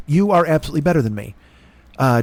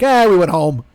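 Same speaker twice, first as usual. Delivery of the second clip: lazy shop owner; 3 semitones higher, 5 dB louder than usual. Retold or imitated.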